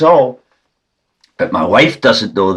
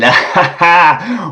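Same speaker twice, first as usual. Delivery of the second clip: laugh; 9 semitones higher than usual. Real, not acted. Laughs at own plan.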